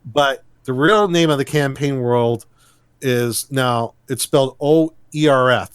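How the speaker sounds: ordinary talk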